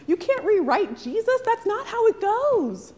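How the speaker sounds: high, loud mock outburst at the screen; imitated yell. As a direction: yell it at the movie screen, half joking. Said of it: "not to jump up and yell at the screen".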